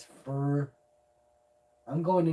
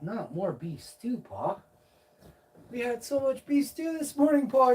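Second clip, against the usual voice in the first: cat voice; 8 semitones higher, 3 dB louder than usual. Pretend. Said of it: "Cat's like".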